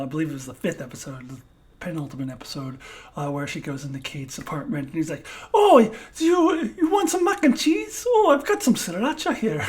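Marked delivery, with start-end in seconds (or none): 5.5-9.6 high, loud, badly faked foreign accent; Yelena's terrible accent. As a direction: attempt a foreign accent and botch it, laughing. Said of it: "that was a horrible accent".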